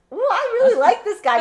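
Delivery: high, loud, giddy ooh; swooning over a crush; gushing swoon. Retold or imitated. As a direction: squeal like a smitten fan. Fangirling over the God of War.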